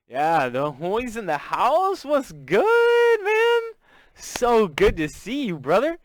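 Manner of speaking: loud, high hype greeting; big stretched-out shout-out to a friend; excited welcome. Real, not acted. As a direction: welcome a buddy like a hype man, voice leaping up. Hyped welcome for arriving friend.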